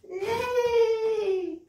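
high, loud whinnying neigh; whinny like a horse. Acted. Librarian neighs like a horse for the children.

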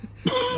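a short high laugh; real amused laugh; high burst of laughter. Real, not acted. Laughing at the story.

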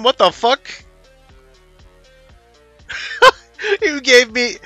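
ordinary delivery

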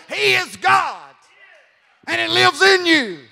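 loud, fired-up exhortation; full-force preaching. Real, not acted. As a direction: build to a high shouted climax, stretching the final phrases. Preacher stirs up congregation.

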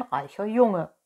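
lightly voiced persuasive fox; fox's promise. A narrator's reading. Fox promises Pinocchio riches, lightly voiced. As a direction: make the promise in a fox's everyday voice.

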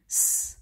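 S as a long voiceless hiss; sound of S. Shown as a demonstration. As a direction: draw out a pure "S" sound, no vowel. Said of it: "em có thể đọc âm". (âm "S" xì gió kéo dài)

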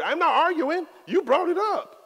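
high, loud protest; indignant retort. Retold or imitated. Preacher retells answering the mall evangelists, high loud protest. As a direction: throw it back, wide-eyed and incredulous.

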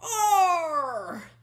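loud, high falling roar; long-held bellow of a hurt giant; giant's pained yell. Acted. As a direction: yowl like a lassoed giant. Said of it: "yelled the giant".

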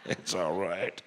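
lightly voiced mumbling dad; mumbled dad. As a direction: murmur it under your breath. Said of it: "this guy in the middle is like".

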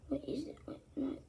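normal talking voice

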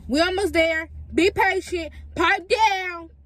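high, loud impatient yell. Real, not acted. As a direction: yell it at the pestering cousins. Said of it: "let me stop yelling".

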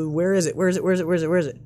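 rapid-fire muttered repetition; hurried searching. Real, not acted. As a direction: repeat it fast while hunting for the option. Hurried search for menu option.

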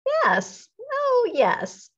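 high-pitched eager yes; eager agreement. A narrator's reading. Hat maker answering Benny's offer of a penny, eager and high.

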